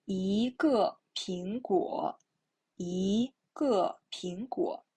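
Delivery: slow "一个苹果" with "一" rising; deliberate reading, upward slide on "一"; second-tone model. Shown as a demonstration. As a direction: stretch and lift "一" in "一个苹果". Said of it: "Okay, the second tone".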